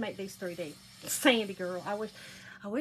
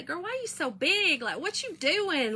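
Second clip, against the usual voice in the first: imagined retort; 7 semitones higher than usual. Retold or imitated. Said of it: "And I wanna say".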